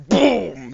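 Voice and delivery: loud, high mouth boom; booming hit on the enemy ship; explosion boom. Acted. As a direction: punch out the impact. Narrating cannon impact.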